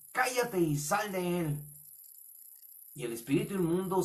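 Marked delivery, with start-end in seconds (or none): 0.1-1.6 slow, higher-pitched command; stern rebuke. Acted. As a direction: rebuke a spirit and order it out. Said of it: "Jesús le reprendió diciendo". (reprensión firme y pausada)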